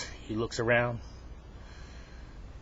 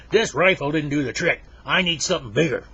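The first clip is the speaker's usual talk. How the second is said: loud, raised-pitch complaint; mad customer; 4 semitones higher, 7 dB louder than usual. Acted. Henry tells the gun shop owner off, mad. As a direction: complain forcefully in acted anger. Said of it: "he's getting madder and madder".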